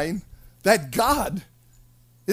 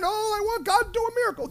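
mock praying; 12 semitones higher, faster than usual. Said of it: "People praying".

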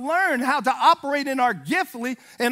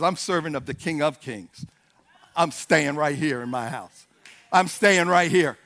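unmoved; 8 semitones lower than usual. Retold or imitated. Elijah's unmoved reply acted out.

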